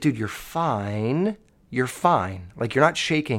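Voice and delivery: low, soft, unbothered reassurance; calm brother. Retold or imitated. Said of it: "the calmest person I've ever fucking met".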